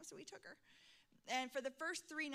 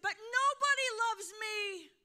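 higher, louder, anguished cry; heartbroken girl; 10 semitones higher, 14 dB louder than usual. Retold or imitated. Preacher voicing the girl crying out that nobody loves her. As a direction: blurt it out in pain. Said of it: "And she said".

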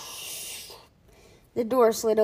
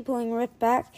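usual speaking voice

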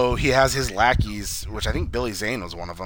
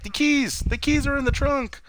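high-pitched exasperated cry; high, hammed-up dismay; mock panic. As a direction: imitate someone frantic, pitched way up. Retold panic over locked-in keys.

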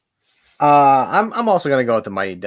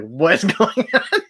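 laughing disbelief; 8 semitones higher, slower than usual. Retold or imitated. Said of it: "just like".

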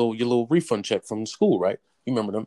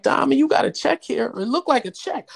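grandma voice; 11 semitones higher, 4 dB louder than usual. Retold or imitated. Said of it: "She was like".

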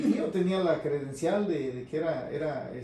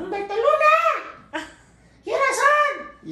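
put-on very high, slow shouted little boy; child voice; 11 dB louder, much slower than usual. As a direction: yell it like a pesky kid calling the radio. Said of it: "Pues yo soy la voz de un niño".

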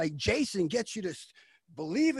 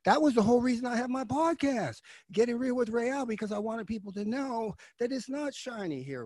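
ordinary talk, nothing marked

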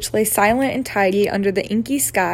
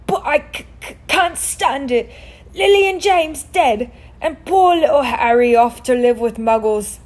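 sobbing; 3 semitones higher, 4 dB louder than usual. A narrator's reading. Giant grieves for Lily and James, sobbing. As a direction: wail it out in hammed-up grief. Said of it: "sobbed Hagrid".

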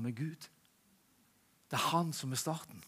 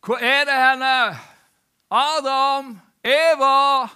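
booming call, much higher and louder; God shouting for Adam and Eve in Eden; 10 semitones higher, 15 dB louder, much slower than usual. Acted. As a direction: call out across the garden for the hiding pair. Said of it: "og da ropte Gud".

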